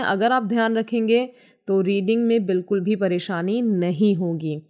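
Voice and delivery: ordinary talk, nothing marked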